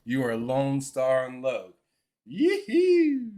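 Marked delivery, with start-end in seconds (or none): 2.3-3.3 high, loud cowboy holler; mock cowboy whoop. Naming his friend Lone Star, mock cowboy whoop. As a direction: whoop it up like a cartoon Texan.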